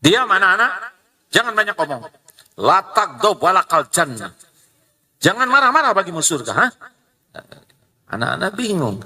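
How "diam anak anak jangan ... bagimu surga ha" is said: angry scolding. Retold or imitated. Parent angrily scolding noisy children. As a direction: scold the kids while preaching calm. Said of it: "Katanya jangan marah sambil marah".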